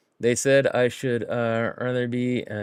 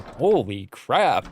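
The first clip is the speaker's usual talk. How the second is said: "Holy crap" stretched slowly in awe; drawn-out holy crap; much slower than usual. Retold or imitated. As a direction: let "Holy crap" hang, slow and stunned. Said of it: "I just remember going".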